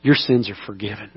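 soft reassuring pardon; tender. Acted. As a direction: let it land gently, almost hushed. Repeated softly as Jesus' words.

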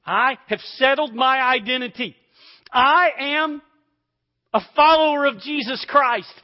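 loud, high, ringing creed; fervent preacher's declaration; preaching at full force. Acted. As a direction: proclaim it boldly to the church.

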